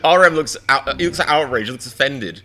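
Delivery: loud, high laughter through words; talking through a loud laugh; laughing hard. Real, not acted. Bursting out laughing.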